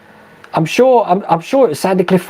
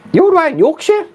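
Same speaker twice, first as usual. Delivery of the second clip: surprised; 12 semitones higher than usual. Real, not acted. Surprised at viewer's trip.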